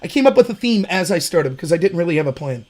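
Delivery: usual speaking voice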